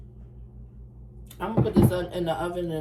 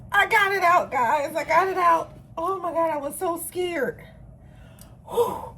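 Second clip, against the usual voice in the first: high-pitched burst of relief, starting loud; relieved excitement; 9 semitones higher, 4 dB louder than usual. Real, not acted. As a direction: cry out in giddy relief. Cake finally comes out of pan.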